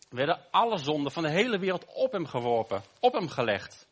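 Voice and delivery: usual speaking voice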